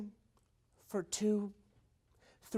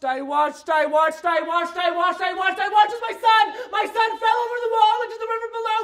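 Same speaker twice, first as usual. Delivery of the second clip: louder and higher, ending in a fast shout; building to a shout; 10 semitones higher, 16 dB louder than usual. Real, not acted. Father watching son fall, building to a shout. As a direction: let the horror climb to a scream. Mounting horror, repeated phrase.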